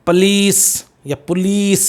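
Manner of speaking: पुलिस first as "pa-lees", then "pu-lees"; two variants of पुलिस, both with long "ee"; long-vowel पुलिस. Shown as a demonstration. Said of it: "एल ई ई एस आता है".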